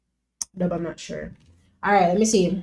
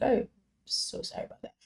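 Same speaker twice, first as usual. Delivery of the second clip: sheepish whisper; whispered apology; 4 semitones higher, 11 dB quieter than usual. Real, not acted. Apologizing to chat for the mix-up, whispered. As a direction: breathe the apology under your breath.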